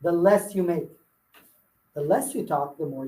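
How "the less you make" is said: emphatic. Real, not acted. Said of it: "remember that".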